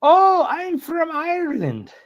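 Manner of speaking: put-on very high, drawn-out old lady coo; older Irish lady. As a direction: exaggerate a delighted older lady, slow and high. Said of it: "And she said".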